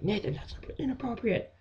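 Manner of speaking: lightly voiced disapproving mom; mom's scolding. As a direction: scold like a prim mother. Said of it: "My mom was like".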